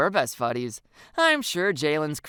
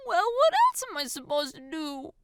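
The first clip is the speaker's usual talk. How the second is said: high, whiny protest; tearful indignation; 13 semitones higher than usual. A narrator's reading. Crying girl to scolding friend, tearful protest. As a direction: wail it helplessly in a high voice. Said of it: "glares at me through her tears".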